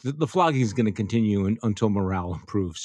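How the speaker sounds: normal talking voice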